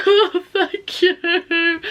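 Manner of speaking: high tearful drawn-out thanks; sobbing. Retold or imitated. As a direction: choke out thanks through happy tears. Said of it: "like, sobbing my eyes out".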